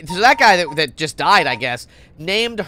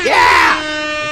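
loud whoop of delight; excited shout; 4 semitones lower, 10 dB louder than usual. Real, not acted. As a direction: cheer it out. Player cheers at game.

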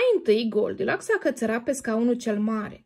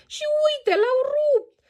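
high, louder wail breaking into tears; baby bear crying; 6 dB louder than usual. Acted. Baby bear finds his chair broken, high crying wail. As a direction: cry out like a heartbroken cub. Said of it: "El a izbucnit în plâns".

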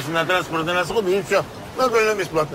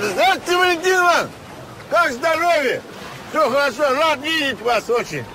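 overly enthusiastic greeting; 8 semitones higher than usual. Acted. Con man's gushing fake welcome.

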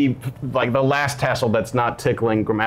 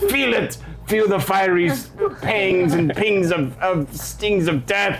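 villainous rug; 7 semitones higher than usual. Acted. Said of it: "it's like".

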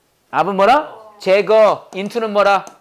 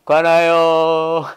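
mock droning; 4 semitones lower, much slower than usual. Shown as a demonstration. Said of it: "그동안은 그냥 다 뭐야".

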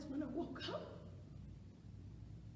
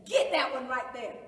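loud police command at usual pitch; shouted order; 11 dB louder than usual. Acted. Policeman singling her out at the bus, loud command. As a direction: order an arrest, loudly.

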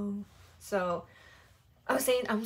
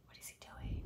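whisper; 13 dB quieter, faster than usual. Real, not acted. Whispered puzzled aside.

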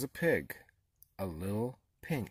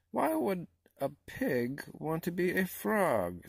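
parrot; 8 semitones higher than usual. A narrator's reading. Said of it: "said the parrot".

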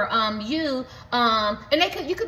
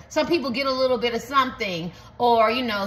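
usual speaking voice

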